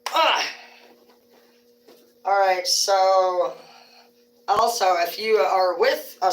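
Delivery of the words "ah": sharp yelp. Real, not acted. Outburst after joke.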